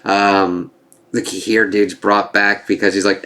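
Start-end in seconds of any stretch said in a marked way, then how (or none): none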